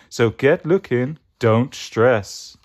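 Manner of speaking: cool hippie character voice; Mystic Mango. Acted. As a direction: urge them on like a groovy dude. Plays Mystic Mango, groovy guru.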